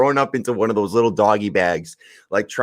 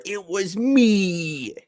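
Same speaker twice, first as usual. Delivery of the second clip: movie quote; 10 semitones higher, much slower than usual. Retold or imitated. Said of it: "Just like in Liar Liar".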